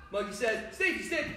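rapid call, high pop on the shape. Shown as a demonstration. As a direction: fire it off quickly, jumping high on the shape. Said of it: "do it a little bit faster".

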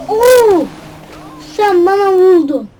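loud, high outburst at being hit; indignant. Real, not acted. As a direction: protest as if wronged. Another car hits him. (çarpan sürücüye öfkeyle bağırır)

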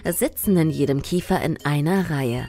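very low, fast reading voice; deep voice. Acted. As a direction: read it in a deep, husky register. Reads fish facts in deep voice.

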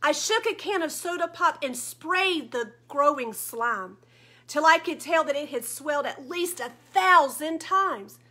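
ordinary delivery, nothing marked

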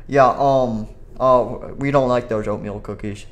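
ordinary delivery

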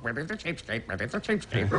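high squawky parrot talk. Retold or imitated. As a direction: screech it like a parrot. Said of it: "talk like a parrot".

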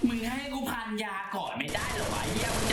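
furious yelling. Real, not acted. Gamer cursing an enemy player who keeps shooting him, furious yelling. Enraged at opponent mid-fight.

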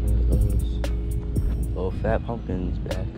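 usual speaking voice

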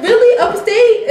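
high, loud, disbelieving questions; mock incredulity. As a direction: imitate a friend not buying it. Retelling friend's incredulous reaction.

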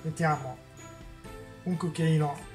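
ordinary delivery

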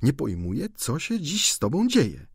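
usual speaking voice

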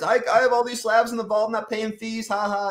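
high mocking voice of a show-off; mocking bragger. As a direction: taunt like a smug collector. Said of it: "that's all they talk about is".

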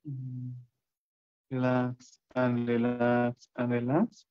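slow, drawn-out countdown with long silences; guided meditation. Acted. Count listeners down into deep relaxation, guided meditation.

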